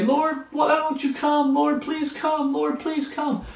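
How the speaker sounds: high, slow whining plea to the Lord; mock whiny pleading. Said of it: "That's the wrong attitude".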